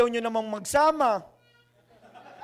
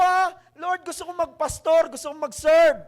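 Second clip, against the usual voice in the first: startled, louder, higher "Ha?" then pleading; loud protest; 5 semitones higher, 7 dB louder than usual. Retold or imitated. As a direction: push back hard, almost shouting. Pastor protests God's call.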